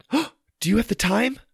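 put-on high-pitched little girl's question; gasp, then ask in a high voice; worried girl. Girl anxiously asks the time.

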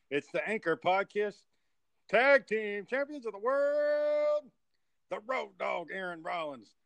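big, slow ringmaster announcement. Acted. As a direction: present them like a circus ringmaster. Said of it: "I only got half of that".

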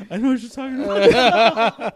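mock helpless-laughter voice; retold laughing admission. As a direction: say it through laughter, as the retold friend. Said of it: "He's dying laughing".

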